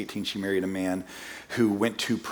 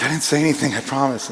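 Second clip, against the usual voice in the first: mock defensive; 4 semitones higher, 5 dB louder than usual. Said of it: "She's like".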